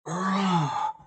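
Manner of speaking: loud, high groan of exasperation; frustrated groan. Real, not acted. Player groaning over a blundered piece, exasperated. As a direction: groan loudly at your own mistake.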